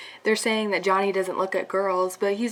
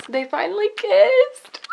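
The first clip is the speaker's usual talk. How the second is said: high, loud burst of excitement; thrilled squeal; 11 semitones higher, 10 dB louder than usual. Real, not acted. Fan reacts to the characters' long-awaited kiss, squealing with excitement. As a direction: squeal it out and break into laughter.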